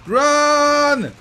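high, loud, drawn-out yell; urgent shout. Real, not acted. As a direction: yell to flee right now. Orders teammates to flee.

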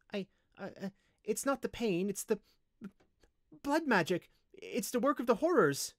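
high, hesitant, frightened voice; frightened slave. A narrator's reading. Slave dreading blood magic, fearfully. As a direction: stammer anxiously, pitched well up. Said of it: "Seeing the fear on the slave's face".

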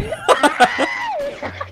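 tawang-tawa. Real, not acted. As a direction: laugh helplessly, up high. Natatawa sa sigaw ng kalaro. (matinis na halakhak)

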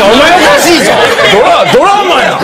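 loud high retort, half laughing; mock outraged yell at the brother-in-law; incredulous shout. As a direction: bellow it like a disbelieving straight man. Retold outburst at the deathbed.

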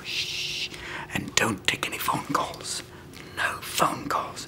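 soft hushed whisper, slow at the end. Retold or imitated. Whispering to Mel to skip phone calls. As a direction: keep it secret, barely voiced.

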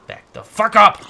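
loud angry yell; scared and angry. Real, not acted. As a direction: yell at it to back off. Yelling at monster.

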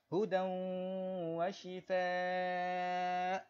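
"هدى وشفاء" with the tanwin slid into the w, drawn out slowly; tanwin merged. Shown as a demonstration. Teacher showing idgham on هدى وشفاء, drawn out slowly. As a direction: melt the nun sound into وشفاء. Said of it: "macam tu".

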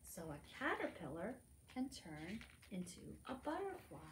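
plain reading voice

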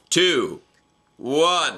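loud, high countdown; countdown call. Real, not acted. Counting viewers down to sync the video, loud countdown call. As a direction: call the numbers out loud, drawn out.

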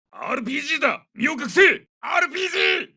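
high, urgent battlefield shout; soldier's alarmed incoming-rocket cry; shouted warning. Acted. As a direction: yell a warning to take cover. Soldier warns of incoming RPG.

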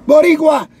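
loud, high proud yell; poet's shout again. Retold or imitated. Street poet calling out to the students, loud proud yell. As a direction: call the word out with pride. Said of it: "screams and yells and says".

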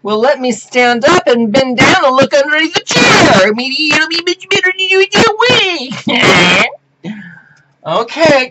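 strut and show off; high put-on voice. Standing up to show America her shorts, high showy voice.

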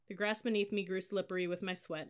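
ordinary delivery, nothing marked

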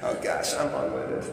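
laughing through the words, mostly breath; real embarrassed laughter. Real, not acted. Priest laughing at his two million words, embarrassed. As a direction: giggle it out, a bit sheepish.

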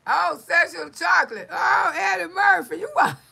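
loud, high, laughing through the words; laughing shout. Real, not acted. Cracking up at a viewer's Eddie Murphy joke, loud laughing shout.

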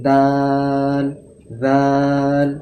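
দাল, জাল with lips drawn thin, light; Arabic letter names, thin mouth. Shown as a demonstration. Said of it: "এইভাবে".

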